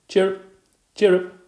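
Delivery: high, loud chirruping; cricket chirps. Acted. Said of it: "Kirk really sounded like a cricket".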